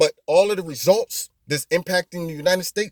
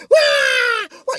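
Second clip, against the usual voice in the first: long, high fake bawling; mock baby wail; 4 dB louder than usual. Host mimics a kid who cried all night, mock wailing. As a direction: imitate a kid still crying next morning. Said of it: "they're still in the bed".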